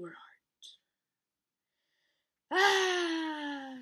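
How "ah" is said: high, loud theatrical howl; howl of defeat. Acted. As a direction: howl like a slain queen. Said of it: "Sunny howled".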